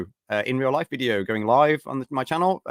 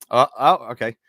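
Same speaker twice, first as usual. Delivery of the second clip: usual speaking voice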